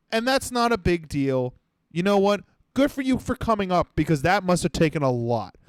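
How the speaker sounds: ordinary delivery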